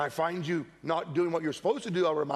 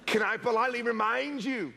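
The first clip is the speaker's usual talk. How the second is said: usual speaking voice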